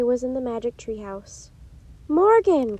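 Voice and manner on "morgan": loud, high call with a falling end; joyful cry. A narrator's reading. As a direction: cry out the name in delighted greeting. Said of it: "cried Annie".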